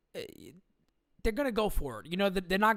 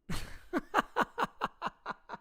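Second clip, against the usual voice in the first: squeaky airy laugh, no voice; high breathy giggle; 5 dB quieter than usual. Real, not acted. Streamer giggling at chat, airy high laugh.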